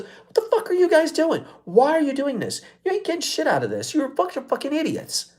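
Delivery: imitating furious heckler. Heckler yelling at the men doing push-ups, furious. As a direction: channel the guy who stole the mic and tore into them. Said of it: "grabbed the mic from this girl and says".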